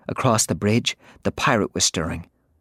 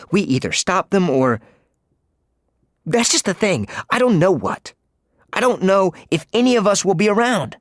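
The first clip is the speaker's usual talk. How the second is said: put-on higher, urgent frightened boy; scared boy pleading; 10 semitones higher, 3 dB louder than usual. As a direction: spill out your fear to her.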